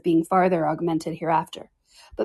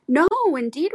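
higher, mock-earnest protest; affected earnestness; 8 semitones higher, 4 dB louder than usual. A narrator's reading. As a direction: overplay the denial. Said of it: "with affected earnestness".

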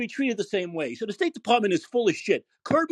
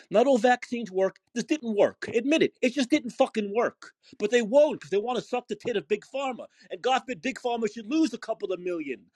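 ordinary talk, nothing marked

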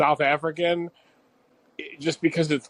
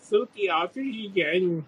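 slow, drawn-out botched South African; weird South African accent; 3 semitones higher, 4 dB quieter, much slower than usual. Shown as a demonstration. Podcaster giving up on the accent, slow weird South African. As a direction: stretch out a mangled South African. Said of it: "I can't. It's really weird".

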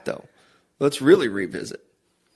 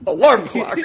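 laughing; 8 semitones higher than usual. Real, not acted. Repeats quote laughing.

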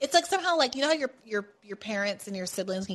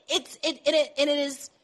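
ordinary delivery

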